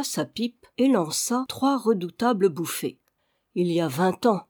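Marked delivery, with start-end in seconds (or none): none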